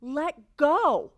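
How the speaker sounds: a loud mock yell from the trainer. Trainer calling to rider on runaway horse, loud mock yell. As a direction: bark the order like the trainer. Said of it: "I hear the woman yell".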